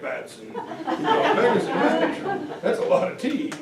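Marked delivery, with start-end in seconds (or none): none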